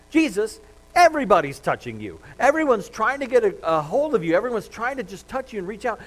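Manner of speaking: loud, high and incredulous, pleading with him; exasperated disciples. Acted. Disciples protest to Jesus in the crowd, loud and incredulous. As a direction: throw up your hands, almost shouting.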